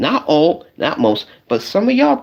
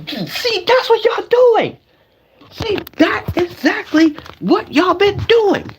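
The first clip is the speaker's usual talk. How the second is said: mock exasperated squeal; 13 semitones higher than usual. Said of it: "guys, I'm gonna show you".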